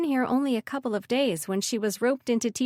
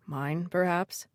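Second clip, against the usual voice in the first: suggestive; 3 semitones lower, 4 dB quieter, slower than usual. Acted. Suggestive invitation to girlfriend.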